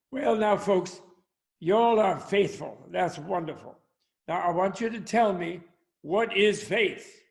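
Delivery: put-on American Southern accent. Preacher quizzing his Sunday congregation, American Southern accent. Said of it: "going into an American Southern accent".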